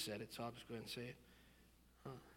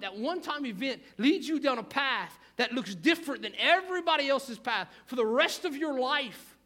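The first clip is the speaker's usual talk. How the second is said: loud, high-pitched sermon urgency; impassioned preacher, raised and insistent; forceful preaching; 8 semitones higher, 11 dB louder than usual. Real, not acted. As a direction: drive it home to the congregation at full voice.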